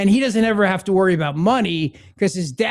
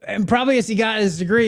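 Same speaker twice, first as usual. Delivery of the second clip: usual speaking voice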